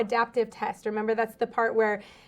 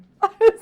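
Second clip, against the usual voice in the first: laughing; 10 semitones higher, 10 dB louder than usual. Real, not acted. Laughs at her iLearn joke.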